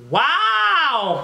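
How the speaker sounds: high, loud amazed wow. Real, not acted. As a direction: react big to the smell. Reacting to strong smell.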